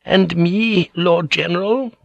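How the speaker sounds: high, thin, tentative question; weak old lady. A narrator's reading. Old lady tests the general's concession, weakly. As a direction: timidly pipe up as an old woman. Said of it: "old Lady Etsu asked weakly".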